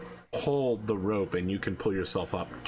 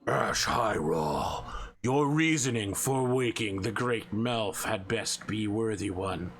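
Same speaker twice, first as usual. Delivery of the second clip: dragon voice; slower than usual. Acted. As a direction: speak like a looming dragon. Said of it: "his face turns into that of a dragon, and he goes".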